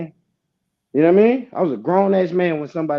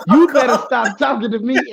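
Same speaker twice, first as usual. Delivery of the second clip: mock cursing aunt; 6 semitones higher, 3 dB louder than usual. Retelling aunt yelling at nobody.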